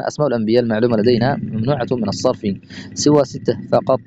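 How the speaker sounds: ordinary delivery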